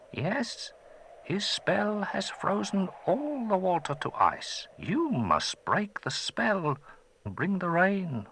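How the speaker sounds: put-on a gentle old wizard, curious; old magician. Old man thanking the boy who freed him, gentle.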